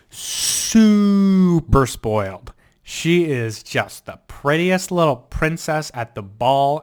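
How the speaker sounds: loud, slow, drawn-out gushing. Real, not acted. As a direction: lay it on thick about the princess. Gushing about the spoiled dog.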